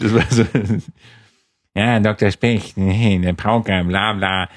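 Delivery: normal talking voice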